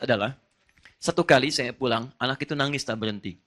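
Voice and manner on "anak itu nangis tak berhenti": usual speaking voice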